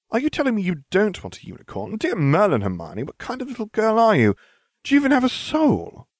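high, incredulous outburst; appalled disbelief. A narrator's reading. Narrator challenges Hermione for not wanting a unicorn, appalled. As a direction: demand an answer, pitch soaring in disbelief.

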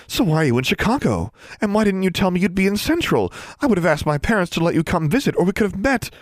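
high, fast eager chatter; babbling. Acted. Smitten girl quizzing her crush, high babbling voice. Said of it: "who was still babbling".

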